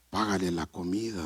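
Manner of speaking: deep, very soft divine voice; voice of God. Retold or imitated. Preacher retells hearing God in the store, low hushed voice. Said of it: "yo lo escuché".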